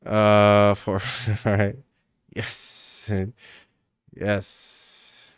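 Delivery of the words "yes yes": breathy whispered yes. Real, not acted. Streamer quietly pleased while scrolling, whispering. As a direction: barely breathe it out.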